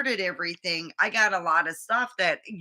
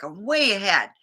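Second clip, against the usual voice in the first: ordinary talk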